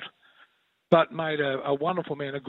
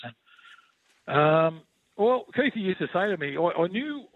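ordinary talk, nothing marked

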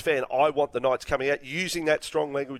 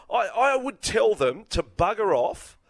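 higher, slow and firm; indignant; 7 semitones higher, slower than usual. Real, not acted. Host venting at the Roosters, slow and indignant. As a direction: spell it out with contempt. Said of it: "I'm angry".

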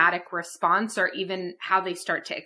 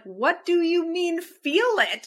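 baffled; 8 semitones higher, slower than usual. Retold or imitated. Retold baffled reply to therapist.

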